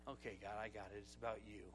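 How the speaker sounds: very low and hushed; chastened, barely voiced reply; subdued surrender. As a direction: give in to God quietly, deep and small. Humbled reply to God's rebuke.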